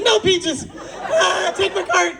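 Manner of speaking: a hammed-up panicked surrender; comic fear handing over her cart to Peaches; mock scared plea. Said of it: "I was like".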